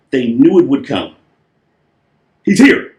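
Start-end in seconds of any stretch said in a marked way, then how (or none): 2.4-2.9 high, loud cry of joy; joyful shout. Acted. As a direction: shout the good news with delight. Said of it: "they shouted".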